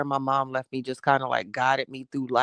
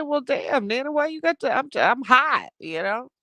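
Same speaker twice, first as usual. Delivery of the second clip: put-on much higher, playful childlike whine to her nana; whiny child protest; 11 semitones higher than usual. Teasing her nana in a high childish whine. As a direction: pitch it way up like a kid caught out. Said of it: "I said".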